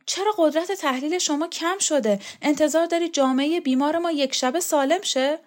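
put-on puzzled young man pressing his point; Borzu's voice. Borzu lecturing Shokat.

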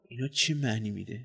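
very soft, private question; murmuring to oneself. A narrator's reading. Puzzled visitor wonders to himself, very quietly. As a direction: ask it under your breath. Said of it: "آهسته با خود گفتم".

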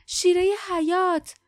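loud, high cry; urgent shout. A narrator's reading. Lief calls for the life-saving nectar, shouting. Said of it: "لیف همزمان فریاد زد".

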